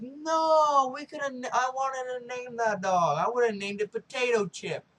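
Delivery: loud, high-pitched protest of grief; mock wail. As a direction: wail it out in over-the-top dismay. Acted distraught reaction to dog's death.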